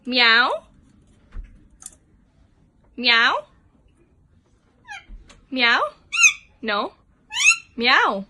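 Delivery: cat meow. Shown as a demonstration. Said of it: "What does a cat say?".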